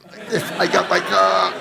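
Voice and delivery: loud, high celebration of a win; imitated gleeful friend. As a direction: crow it loudly, mimicking an overjoyed friend. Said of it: "He's going like that".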